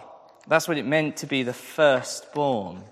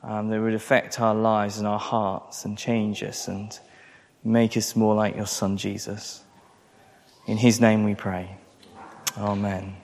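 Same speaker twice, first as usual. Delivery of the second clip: prayer; 4 semitones lower than usual. Acted. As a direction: pray humbly to God before the sermon. Pastor prays before the sermon.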